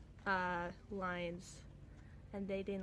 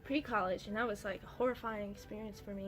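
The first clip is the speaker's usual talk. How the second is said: ordinary delivery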